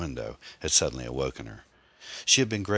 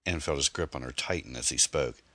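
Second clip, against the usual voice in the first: ordinary delivery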